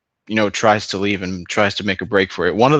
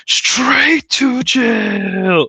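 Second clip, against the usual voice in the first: high, drawn-out catchphrase; catchphrase call; 13 semitones higher, much slower than usual. Retold or imitated. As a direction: stretch it out high and slow. Catchphrase from the videos.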